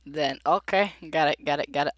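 ordinary talk

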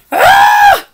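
very high, loud cartoon scream; terrified scream. Pigeon frightened by the puppy, shrieking.

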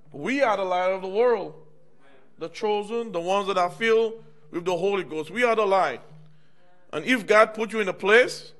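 loud, high preaching build; full-force preaching. Acted. Preacher rouses the church about being the light, loud. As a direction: preach it out to the congregation.

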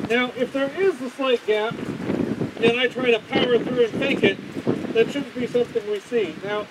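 muffled behind face respirator; speaking inside sealed mask; through a respirator mask. Real, not acted. Seen 1: Half-face respirator with pink filters worn over mouth throughout; absent earlier.

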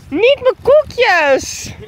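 high, stretched-out protest; dismayed wail. Real, not acted. Meeuwen pakken haar koekjes. (wanhopig gejammer)

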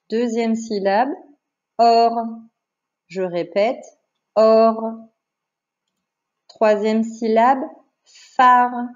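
slow teacher dictating syllables, evenly spaced with pauses; measured classroom dictation voice. Shown as a demonstration. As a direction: announce each item slowly, then give the syllable clearly on its own.

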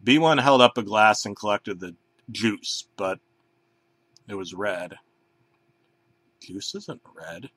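ordinary delivery, nothing marked

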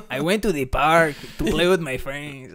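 slow cartoon Mexican mouse voice; Speedy Gonzalez style Mexican accent, stretched out; Speedy Gonzalez impression. Shown as a demonstration. Repeating friend's sentence as Speedy Gonzalez with a Mexican accent. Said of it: "I'm doing a Speedy Gonzalez fucking impression".

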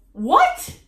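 high, loud, outraged "What?"; shocked what. Retold or imitated. Vlogger replaying her shocked reaction to the mom comment. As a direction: squeal it in total disbelief. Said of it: "And I was like".

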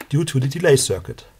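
normal talking voice

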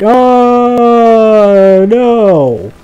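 high, loud mock panic; startled cry. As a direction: yelp it like the giant almost got you. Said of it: "I was like".

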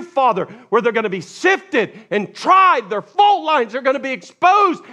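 high, loud, soaring preacher's cry; full-force preaching. Acted. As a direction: preach it out with fire. Preaching Jesus' words forcefully.

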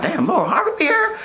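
high-pitched retold amazement; retold surprise. Man recalling spotting a celebrity at his building, surprised. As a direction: replay your surprise, pitched well up. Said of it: "I was like".

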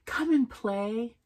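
ordinary delivery

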